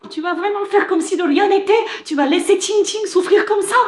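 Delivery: high-pitched, outraged demand; indignant appeal. Acted. Woman confronting a man over Qingqing, indignant.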